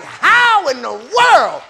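high, loud, drawn-out incredulous cry; slow, shouted mock disbelief; acted amazement. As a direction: imitate stunned onlookers, stretching the words. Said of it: "they gonna be like".

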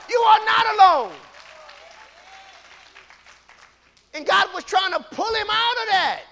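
2 high, loud shouted preaching; 3 shout the story of Elijah out; 1 full-force preaching. Real, not acted. 4 preacher shouting the Elijah story to the congregation. Preaching climax, God voiced.

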